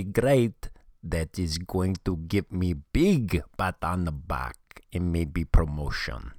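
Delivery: a cartoon Russian hacker villain accent, dragged out for effect; [name]'s bad Russian accent. Russian hacker taunts America's podcast listeners, in a hammed-up Russian accent. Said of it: "the worst Russian accent that I've ever heard".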